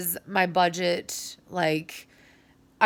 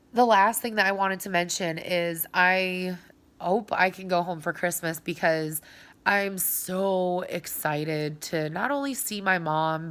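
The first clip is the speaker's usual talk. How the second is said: ordinary talk, nothing marked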